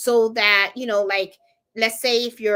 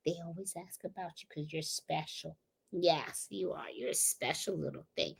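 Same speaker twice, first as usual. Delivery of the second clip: soft, close, fond; soft doting voice to the dog; tender murmur; 5 semitones lower, 14 dB quieter than usual. Real, not acted. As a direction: murmur sweet nothings to the dog.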